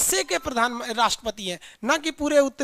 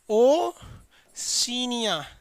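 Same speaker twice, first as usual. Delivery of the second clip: Oceania stretched very slowly, every syllable held; drawn-out word; much slower than usual. Shown as a demonstration. Teacher drilling students on the new continent, stretched out. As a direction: draw out Oceania long for the class to memorise.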